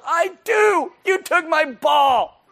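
very high, loud, slow bratty whine; toddler tantrum. Acted. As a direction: shriek it like a toddler robbed of a toy. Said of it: "like a two-year-old".

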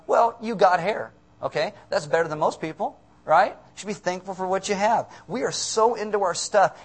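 ordinary talk, nothing marked

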